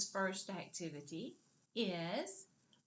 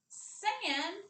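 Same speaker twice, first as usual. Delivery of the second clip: loud, high call of sandbox; loud syllable; 10 semitones higher, 5 dB louder than usual. Shown as a demonstration. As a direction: shout "Sandbox" up high as you stand. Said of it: "say the other part loudly".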